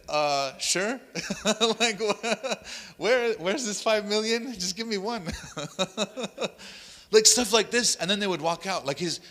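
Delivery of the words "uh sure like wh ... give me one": bemused reply. Retold or imitated. Bewildered man answering, laughing.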